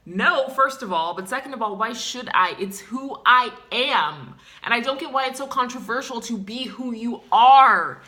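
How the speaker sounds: building furious proclamation; defiant shouting. Acted. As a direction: rage at the world's unfairness. Defiant rant about identity.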